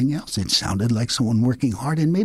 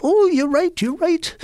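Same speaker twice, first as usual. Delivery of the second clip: much higher, small and dismayed; high meek rabbit voice; 4 dB louder than usual. Acted. Rabbit meekly agreeing with sly Coyote.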